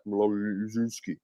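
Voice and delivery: "Mlodzinski" said slow and soft in a light Russian accent; mock Russian accent. Shown as a demonstration. Sportscaster showing his co-host the name in a light Russian accent. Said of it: "if you say it in a Russian accent".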